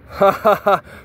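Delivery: loud high burst of laughter; defiant laugh. Real, not acted.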